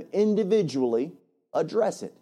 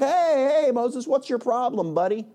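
mock challenge; 7 semitones higher than usual. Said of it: "Nobody was like".

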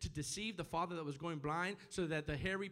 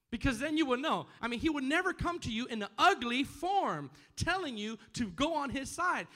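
loud, high, emphatic preacher; full-force preaching; 8 semitones higher, 8 dB louder than usual. Real, not acted. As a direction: drive each phrase home to the congregation.

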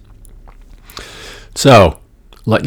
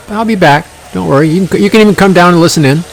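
cheery; 8 semitones higher, 4 dB louder, faster than usual. Real, not acted. Talking to party guest, cheerful.

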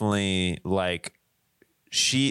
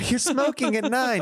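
high, slow shocked mother; scolding mom; 13 semitones higher than usual. Pretend. Cohost voicing the mom scolding young Eleanor, high and slow. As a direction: drag it out up high like an appalled mom.

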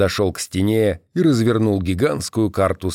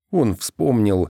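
ordinary delivery

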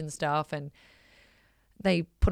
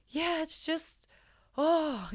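imitating choked-up husband; 11 semitones higher, much slower than usual. Wife imitating her husband choked up after the Make-A-Wish moment, mock teary and high. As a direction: play him fighting back tears, lightly. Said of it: "And he's like".

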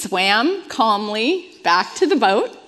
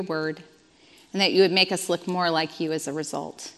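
quiet prayer; 4 semitones lower, 4 dB quieter than usual. Acted. Pastor praying for her congregation, soft and reverent. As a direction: pray gently over the congregation.

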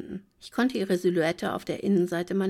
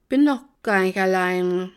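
slurred tipsy words, louder; slurring drunk; 7 dB louder than usual. Acted. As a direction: slur it like a drunk woman. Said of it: "lallte ich". (lallend, betrunken gespielt)

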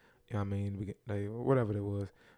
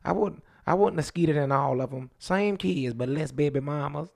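Louisiana drawl, a touch higher and louder; 4 semitones higher, 4 dB louder than usual. Retold or imitated. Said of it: "That's how [name] talk".

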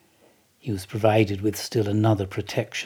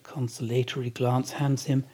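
ordinary delivery

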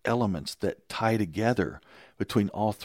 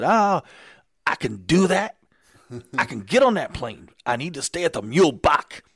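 imitating Rudy; 7 semitones higher, 7 dB louder than usual. Retold Rudy agreeing on phone.

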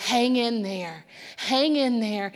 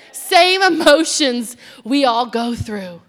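normal talking voice

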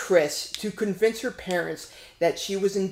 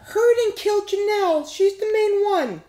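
much higher, laughing through it; even higher girl voice; 13 semitones higher, 3 dB louder than usual. Shown as a demonstration. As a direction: push the girl voice way up. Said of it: "Like, make it higher?".

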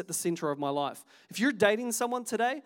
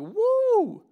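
high, loud, drawn-out whoop; mock "woo"; 14 semitones higher, 8 dB louder than usual. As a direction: react to wacky theories with a high hoot. Reacting to friends' conspiracy posts.

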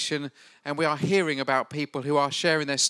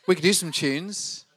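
ordinary delivery

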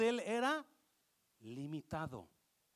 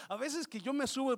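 ordinary talk

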